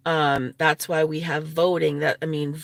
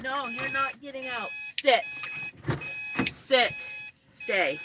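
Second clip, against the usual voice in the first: firm dog commands; 7 dB quieter than usual. Real, not acted.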